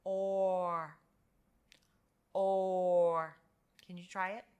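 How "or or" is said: "or" held long and loud; OR sound. Shown as a demonstration. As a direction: draw out "or" as one sound. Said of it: "it makes the sound".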